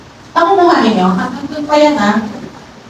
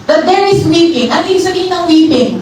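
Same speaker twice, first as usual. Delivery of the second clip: ordinary talk, nothing marked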